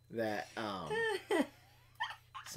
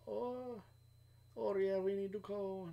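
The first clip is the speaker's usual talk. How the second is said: a Puerto Rican accent attempt that drifts elsewhere, higher; failed Puerto Rican accent; 7 semitones higher, 5 dB quieter than usual. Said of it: "No, that's more Chinese".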